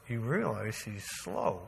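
low, slow, quiet objection; skeptical wife. Retold or imitated. Wife to husband about the checkout line, low skeptical objection. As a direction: imitate a wife's doubtful aside, drawn out.

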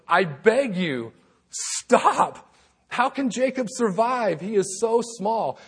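high, slow and loud plea; crying out. Acted. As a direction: cry out to God, drawn out. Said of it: "Then he cried out".

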